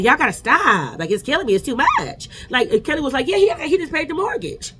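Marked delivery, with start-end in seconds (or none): 0.0-2.3 mocking. Mocking Shamil's thank-you speech. 3.3-4.7 high sarcastic. Retold or imitated. Kelly quoted sarcastically.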